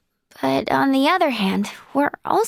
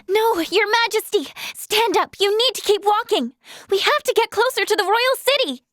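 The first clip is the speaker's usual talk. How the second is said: high, desperate insistence; urgent pleading; 5 semitones higher than usual. Acted. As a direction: urge the wounded monarch onward. Urging wounded royal to keep moving.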